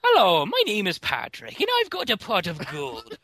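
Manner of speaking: high-pitched, cartoon leprechaun brogue; Irish leprechaun accent. Shown as a demonstration. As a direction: play a hammed-up Irish cereal leprechaun. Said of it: "the fucking Lucky Charms leprechaun".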